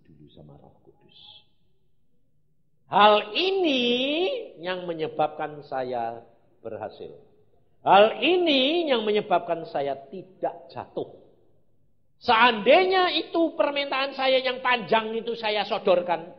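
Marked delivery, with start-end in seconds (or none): 2.9-7.2 very loud, high cry; emphatic preaching. Real, not acted. Preacher announces the key to his success, emphatic shout. 7.8-16.3 loud, high, sustained proclamation; emphatic preaching. Real, not acted. Preacher warns what would have caused his fall, emphatic shout. Preacher hammering the key point.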